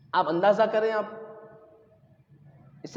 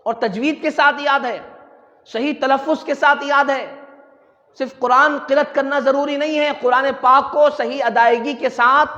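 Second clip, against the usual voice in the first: raised, emphatic sermon voice; forceful preaching; 4 semitones higher, 5 dB louder than usual. Real, not acted. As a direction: press each point home to the congregation.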